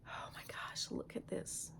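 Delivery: hushed whisper of wonder; whispering at something too gorgeous to believe; awed whisper. Real, not acted. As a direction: breathe it out as if afraid to spook it. Awed over a creepy piece.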